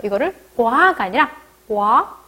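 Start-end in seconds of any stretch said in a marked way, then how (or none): 1.7-2.2 와 with no k sound; southern Vietnamese reading. Shown as a demonstration. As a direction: open straight on w in 와. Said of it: "남부에서는 어떻게 읽을까요".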